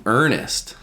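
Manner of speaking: ordinary delivery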